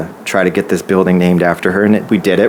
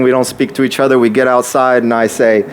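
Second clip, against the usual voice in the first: ordinary delivery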